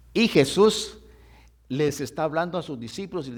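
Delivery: loud, emphatic sermon delivery; fervent preacher calling out the verse; preaching. Acted. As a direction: preach it out to the congregation. Preacher explains Bible verse.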